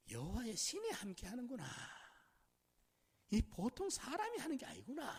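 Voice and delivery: retold amazement with lifted pitch; acted marvelling. Master marvels at Joseph in retelling.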